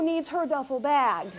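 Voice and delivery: normal talking voice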